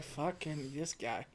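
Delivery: grumbled aside. Retold or imitated. Green annoyed as Bond arrives.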